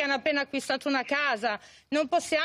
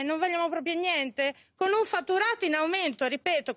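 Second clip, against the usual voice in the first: ordinary talk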